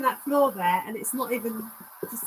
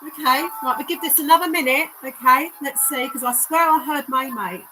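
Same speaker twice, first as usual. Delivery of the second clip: ordinary talk, nothing marked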